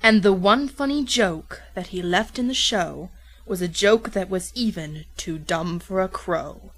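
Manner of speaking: ordinary delivery, nothing marked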